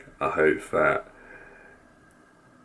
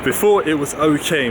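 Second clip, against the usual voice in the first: louder over wind; 10 semitones higher, 7 dB louder than usual. Real, not acted. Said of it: "It's probably really windy and you can't hear me".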